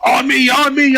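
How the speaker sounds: high-pitched mock cry to teammates; imitated field call. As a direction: imitate Steve calling out under fire. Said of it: "you can definitely tell, 'cause he's crawling".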